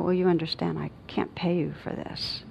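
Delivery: hushed, softly detached calm; gentle refusal. Retold or imitated. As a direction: say it very gently, nearly in a whisper. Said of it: "I just said very gently".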